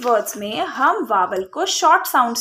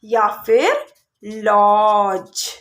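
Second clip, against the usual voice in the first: slow sounding-out; 3 semitones lower, 4 dB louder, much slower than usual. Shown as a demonstration. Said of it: "Like हमने लिखा जज".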